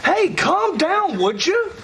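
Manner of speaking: high-pitched, panicky scuffle cries; alarmed protest. Acted. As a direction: fend off an attacker in alarm. Being attacked by angry father.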